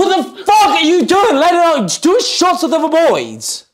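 high-pitched indignant rant; mock outrage. Coach berating friend for accepting a drink, mock outraged. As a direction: shout it in exaggerated disbelief.

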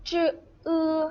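จือ then อือ, high and level; sounding out a syllable. Shown as a demonstration. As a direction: hold จือ and อือ apart on one high pitch. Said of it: "ดังนั้นคำนี้จะเป็น".

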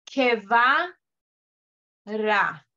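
"Que va" then "ra" said as separate syllables; syllables split apart. Shown as a demonstration. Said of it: "¿escucharon?".

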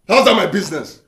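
loud, high angry challenge; angry shout. Acted. Boss rejecting a worker's objection, angrily shouting. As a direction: throw the question back at full volume.

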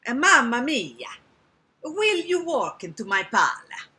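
hammed-up Italian accent. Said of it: "I can make him Italian".

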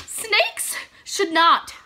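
slow, deliberate, every word weighted; firm refusal. Real, not acted. Vlogger telling viewers snakes don't belong on faces, slow and firm. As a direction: lay it down like a rule. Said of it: "contrasting cucumbers with snakes".

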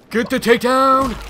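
very loud, high-pitched yell; triumphant shout. Real, not acted. Gamer landing the takedown, triumphant shout. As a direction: shout it out as the move lands. Player lands the hoped-for takedown.

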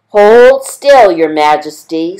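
squeaky mouse. Acted. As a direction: squeak it urgently to the trapped lion. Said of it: "squeaked the mouse".

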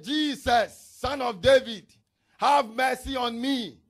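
loud, desperate cry for mercy. Acted. Blind man begging Jesus over the crowd, loud cry.

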